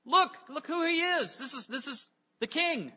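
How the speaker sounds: high excited cry of an onlooker crowd. Acted. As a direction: call out to everyone that this is the king. Crowd hailing the king.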